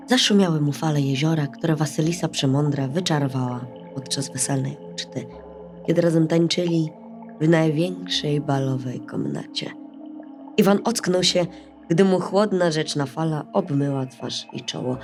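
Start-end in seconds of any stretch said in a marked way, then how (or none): none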